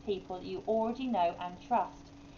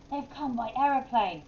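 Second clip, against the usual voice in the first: cried out; 4 semitones higher, 4 dB louder than usual. A narrator's reading. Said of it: "cried Crazy Cat".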